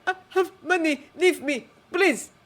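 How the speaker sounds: put-on high, loud desperate French-accented plea; frightened broken English. Director offers Jake money, high frightened plea. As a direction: beg for mercy, high and loud. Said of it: "in broken English he says".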